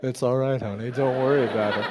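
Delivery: low, soft, put-on calm; playing it cool. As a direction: fake a casual reassurance, hiding the panic. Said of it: "I'm trying to play it cool".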